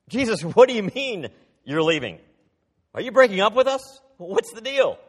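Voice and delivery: high, loud, rushed panicking disciple; mock panic. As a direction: blurt it out like a frightened follower. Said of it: "the disciples were starting to panic".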